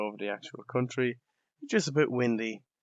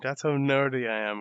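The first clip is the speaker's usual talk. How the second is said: usual speaking voice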